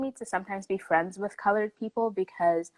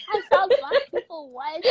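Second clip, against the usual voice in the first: high, loud, laughing glee; overexcited; 12 semitones higher, 6 dB louder, slower than usual. Retold or imitated. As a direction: burst out laughing with giddy amazement. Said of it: "I got a little too overexcited".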